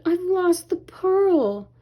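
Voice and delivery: worried princess lament; dismayed. A narrator's reading. Ariel despairs over lost pearl.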